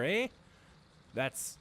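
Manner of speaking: normal talking voice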